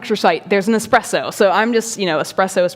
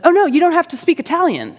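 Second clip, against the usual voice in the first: high, quick imitation of an eager stranger; eager reassurance; 9 semitones higher, faster than usual. Young gentleman reassures the non-Italian speaker, eager. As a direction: brush the apology aside brightly.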